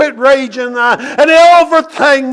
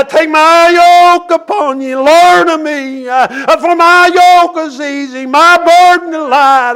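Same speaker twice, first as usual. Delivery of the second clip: preaching whoop; 4 semitones higher than usual. Acted. Preacher to his congregation, quoting Jesus' invitation in a high ringing chant. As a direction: sing out the scripture like a climax.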